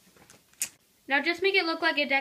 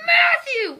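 high, loud crying shout; pained shout; 13 semitones higher, 6 dB louder than usual. Real, not acted. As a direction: yell his name in pain. Hit in the eye, yelling.

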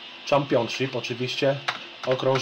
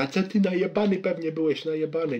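mock accusing; 6 semitones higher than usual. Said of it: "Stawiała przy tym".

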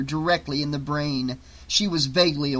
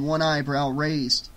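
ordinary delivery

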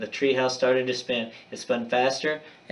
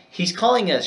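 higher, urgent shout; 6 semitones higher, 4 dB louder than usual. A narrator's reading. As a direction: call it out over the storm, pitched up. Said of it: "shouted Annie".

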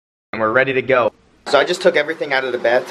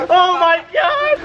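shrieking laughter; much slower than usual. Real, not acted. Firecracker prank reaction.

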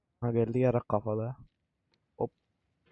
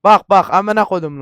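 loud, raised burst of swearing; angry outburst; 6 semitones higher, 13 dB louder than usual. Real, not acted. Gamer cursing as the climb goes wrong, angry outburst. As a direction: snap loudly at the game. Player erupts at game mishap.

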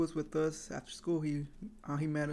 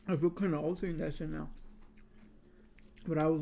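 chewing cereal while talking; talking between spoonfuls; mouth full. Real, not acted. Seen 1: Repeatedly spooning cereal into mouth, visibly chewing while speaking.

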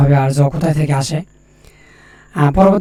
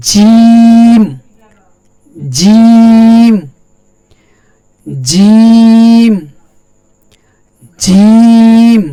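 জিম called out high and loud, drawn out; clear recitation; 4 semitones higher, 8 dB louder, much slower than usual. Shown as a demonstration. Teacher reciting জিম loudly for students to repeat. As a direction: project জিম like a Quran recitation. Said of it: "এটা ভালোভাবে বলবেন".

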